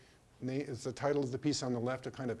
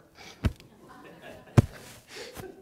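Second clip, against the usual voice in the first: emotional laugh; 4 dB louder than usual. Real, not acted. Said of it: "I'm getting emotional".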